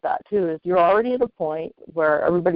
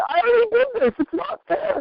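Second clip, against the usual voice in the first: whiny complaint. Acted. Whining that weight returns.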